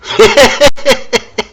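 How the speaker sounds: high rapid giggle; real high, chuckling laugh. Real, not acted. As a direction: break into helpless high-pitched laughter. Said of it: "My apologies for laughing".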